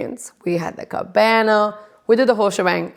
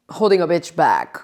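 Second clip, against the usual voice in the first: ordinary talk, nothing marked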